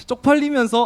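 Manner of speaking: excited exclamation. Real, not acted. Recalling embarrassing moment.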